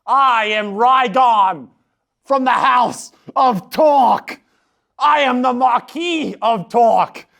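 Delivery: slow, booming noble proclamation; a lord proclaiming his title to the council; grand lord introduction. Acted. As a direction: announce yourself like a self-important marquis. Character introduces himself grandly.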